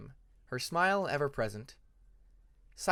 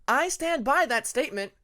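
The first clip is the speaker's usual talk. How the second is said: high, louder proud declaration; 10 semitones higher, 7 dB louder than usual. A narrator's reading. Hyena defending her chalice scheme to the winglet, proudly. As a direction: stand tall and own it. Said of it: "rather proudly".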